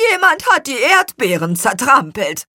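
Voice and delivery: high, loud accusing answer; grumpy character. A narrator's reading. Apple man answers suspiciously.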